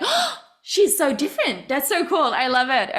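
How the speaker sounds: surprised gasp. Retold or imitated. Mimicking her own surprised thought.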